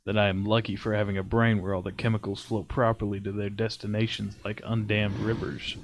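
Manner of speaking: steady story reading voice; story narration. Acted. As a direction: read it like a creepy tale. Reads a creepypasta aloud.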